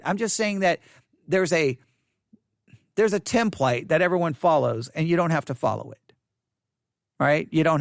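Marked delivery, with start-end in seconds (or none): none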